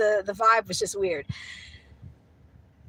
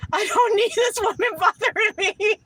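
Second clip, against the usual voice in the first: laughing relief; 9 semitones higher, 4 dB louder than usual. Retold or imitated. Laughing relief after escaping.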